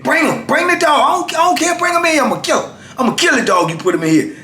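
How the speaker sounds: high, blustering mock defiance; prideful bravado. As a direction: act out pride talking big. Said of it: "my pride was saying".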